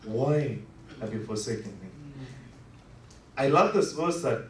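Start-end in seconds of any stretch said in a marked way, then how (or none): 0.1-1.9 soft, low, dying away to almost nothing; anguished fading cry. Retold or imitated. Preacher voicing Jesus on the cross, fading to a broken hush. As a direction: let the cry fade into exhaustion. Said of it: "who asked".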